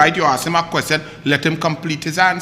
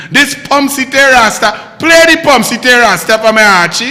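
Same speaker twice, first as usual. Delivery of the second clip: calling out; 3 semitones higher, 6 dB louder than usual. Real, not acted. Calling to producer to play clip.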